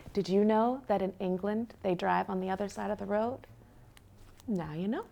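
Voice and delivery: put-on hushed, confiding question to the children; soft aside to listeners. A narrator's reading. Reading to kids, a soft hushed aside. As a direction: lean in and ask the little listeners softly.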